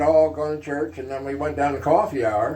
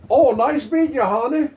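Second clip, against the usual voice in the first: put-on high, louder Texas accent; Lyndon Johnson; 10 semitones higher, 4 dB louder than usual. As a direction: toss off a quick Texas-accented goodbye. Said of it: "pronounced Texas accent like that".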